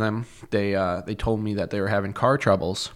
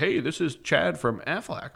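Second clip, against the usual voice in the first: a slow, lightly higher recorded-sales voice; robot caller; 6 semitones higher than usual. Retold or imitated. Imitating the Aflac robocall, slow and canned. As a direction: mimic a canned robocall greeting. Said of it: "a robot from Aflac".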